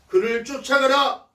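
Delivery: loud, high, commanding proclamation; God's answer. Retold or imitated. God answers David's prayer, loud high command. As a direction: deliver a divine order at full voice. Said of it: "뭐라고 응답하십니까?".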